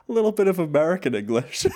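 high, laughing; giggling through it. Real, not acted. Laughing list of accents.

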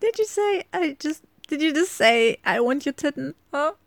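high, loud incredulous question; can't believe what he said; disbelief. Acted. To her partner after his mixed-up German: high incredulous laughing disbelief.

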